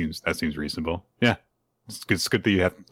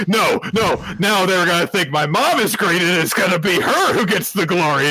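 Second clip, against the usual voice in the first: put-on much higher, childlike panicked cry; panicked Anya; 9 semitones higher, 4 dB louder than usual. Anya protesting to classmates, high panicked child voice. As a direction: squeal it like a flustered little girl. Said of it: "And Anya's like".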